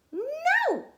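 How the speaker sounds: high, loud, emphatic no; high shouted no; loud refusal. Acted. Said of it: "Stephanie said".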